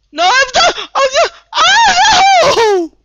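shrill high yell; screaming despair. Real, not acted. Gamer caught and finished off, shrill screaming. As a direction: scream as you get killed.

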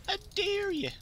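very high put-on voice; silly high-pitched challenge; high mock dare. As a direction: repeat the taunt way up high.

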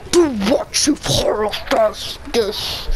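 slow, low villain line; Doctor Doom voice. Shown as a demonstration. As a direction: try a supervillain voice. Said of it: "let me try".

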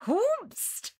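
very high, hooting mock astonishment; mock shock. Co-host reacting to the secret daughter, mock shock. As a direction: whoop it as an exaggerated, scandalised question.

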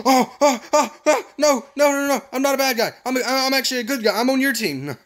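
high, frantic protest; panicked pleading. Real, not acted. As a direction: beg the attacker to stop. Pleads with attacker in game.

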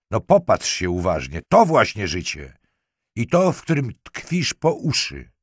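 insistent, rising in pitch toward the end; sharp. A narrator's reading. Lieutenant makes the girl face the dead man, sharp. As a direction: press it home harder phrase by phrase. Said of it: "zapytał ostro".

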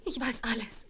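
dramatic whisper. Acted. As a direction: breathe it out as a secret.

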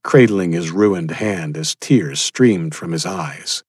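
ordinary delivery, nothing marked

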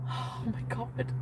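slow unvoiced whisper; breathless whisper. Real, not acted. Overwhelmed by feelings for him, breathless whisper.

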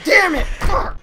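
high, loud panicked curse; scream. Real, not acted. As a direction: yell after the scare.

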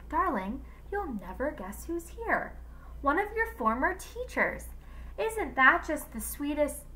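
high, lilting happy announcement; delighted surprise. A narrator's reading. Husband tells his wife who is at the door, delighted. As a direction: gush over the surprise guest.

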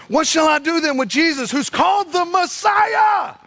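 high, building shout; exasperated appeal. Retold or imitated. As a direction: cry out to the crowd, stretching the last words. Pilate crying out to the crowd.

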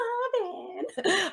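a sudden high laugh that breaks out; burst of high laughter. Real, not acted. Laughs at her own song quote.

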